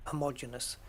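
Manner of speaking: "homogeneous" as huh-MOJ-uh-nus; standard pronunciation. Retold or imitated. Host quoting the speaker who got it right, "homogeneous" as huh-MOJ-uh-nus. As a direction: stress MOJ in "homogeneous", short vowels after. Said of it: "knows how to pronounce it correctly".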